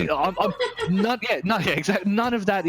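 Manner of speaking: laughing through the words; breaking into a laugh mid-answer. Real, not acted. Answering his co-host's quip, laughing.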